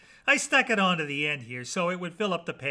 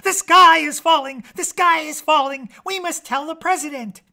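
high, loud clucking alarm; shrieky chicken sounding the alarm; panicked clucking hen; 10 semitones higher, 10 dB louder than usual. A narrator's reading.

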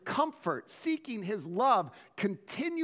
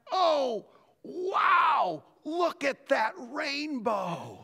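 higher, slow wonder; amazed; 5 semitones higher, much slower than usual. Retold or imitated. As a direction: marvel as it finally appears. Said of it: "and I went".

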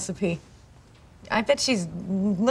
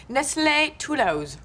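"Nestlé Toulouse" said slowly with a mock French accent; 2 semitones higher, slower than usual. French name said with flair.

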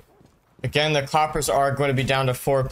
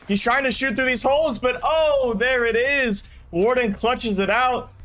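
rising, higher excited play-by-play; 6 semitones higher than usual. Real, not acted. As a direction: build the hype as the clutch unfolds. Clutch moment in live cast.

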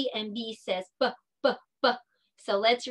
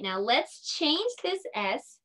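ordinary talk

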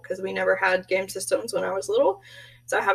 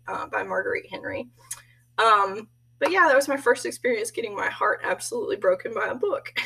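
ordinary talk

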